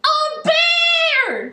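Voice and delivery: very high-pitched frightened shriek; boy's scream. A narrator's reading. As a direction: shriek it high, as the startled boy. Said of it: "screamed Eddie".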